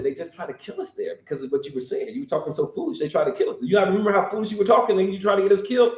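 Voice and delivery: quick, building to high and fast; exasperated scolding. Acted. Thomas scolding Jesus about going back, rushed and exasperated. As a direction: scold him, speeding up.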